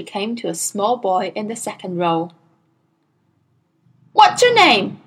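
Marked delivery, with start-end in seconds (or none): 4.1-5.0 high, loud barked question. A narrator's reading. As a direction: bark it at the small boy. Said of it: "she barked".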